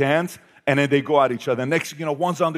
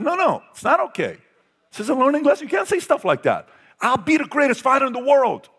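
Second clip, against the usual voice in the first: heated scolding; 12 semitones higher, 4 dB louder than usual. Retold or imitated. Father scolding son retold.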